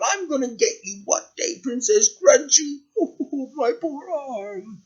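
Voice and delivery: put-on high, thin old-woman voice; soft, high-pitched threat turning into a moan. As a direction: nurse an injury and vow revenge.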